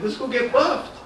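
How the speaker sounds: put-on high Latin American accent, rising ending. Friend inviting comedian along, Latin American accent. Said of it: "a country in Latin America".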